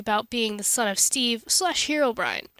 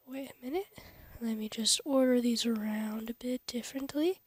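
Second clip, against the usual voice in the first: soft whisper while working; 3 semitones lower, 7 dB quieter than usual. Real, not acted. Editing the video while talking, whispered. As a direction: whisper to yourself as you edit.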